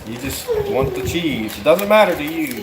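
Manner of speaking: high, whiny imitation of a little girl; mock kid voice. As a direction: lightly play the picky toddler. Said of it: "You said".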